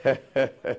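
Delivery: soft, low little laugh; quiet chuckle. Real, not acted. Preacher amused after telling of Abraham, quiet chuckle. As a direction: laugh gently under the breath.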